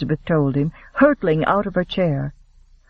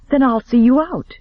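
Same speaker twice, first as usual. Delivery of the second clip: insistent; 7 semitones higher than usual. A narrator's reading. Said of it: "Elizabeth persisted".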